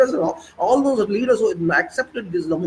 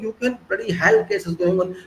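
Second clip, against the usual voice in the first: ordinary talk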